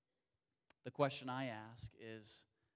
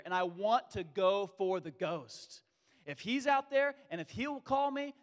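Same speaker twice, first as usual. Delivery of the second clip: louder and higher, slowing on the ghost; fired up to jump out of the boat; Peter's bold resolve; 4 semitones higher, 9 dB louder than usual. Retold or imitated. Peter telling himself to leave the boat, loud and eager. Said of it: "And yet he said".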